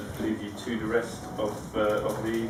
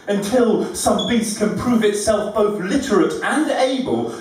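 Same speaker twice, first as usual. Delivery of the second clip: higher, louder declamation in rhythm; comic poetry recital; 9 semitones higher, 8 dB louder, slower than usual. Acted. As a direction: declaim the rhyming verse to an audience.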